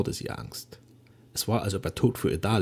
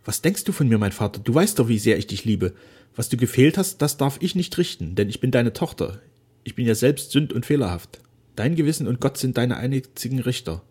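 put-on a touch raised, fervent young woman; a loving daughter reassuring her father; Flora's voice; 4 semitones higher, 6 dB louder than usual. A narrator's reading. Daughter embracing her father, fervently forgiving.

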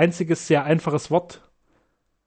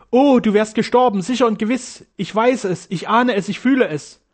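high-pitched, fervent outpouring; impassioned insistence; 7 semitones higher, 4 dB louder than usual. A narrator's reading. Daughter sure her father would have died, impassioned. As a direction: press each conviction on him urgently.

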